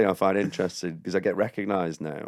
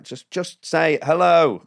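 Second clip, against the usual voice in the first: exasperated plea; 7 semitones higher, 5 dB louder, much slower than usual. Retold or imitated. Acted plea to stranger talking behind him.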